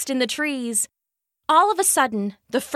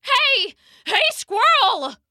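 much higher, loud call up a tree; barked; 10 semitones higher, 3 dB louder, slower than usual. A narrator's reading. Dog barks up the oak at the squirrel, loud high call. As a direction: bark it up at the squirrel. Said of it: "Charlie barked".

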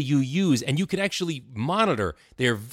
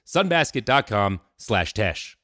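deliberate announcer pace on the web address; slow ad URL read; 5 semitones lower, slower than usual. Acted. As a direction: spell the address out slowly, each part apart.

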